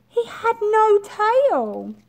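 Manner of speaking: high, loud, slow reveal; slow, high storyteller gasp; dramatic reveal. A narrator's reading. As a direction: announce it with wide-eyed drama.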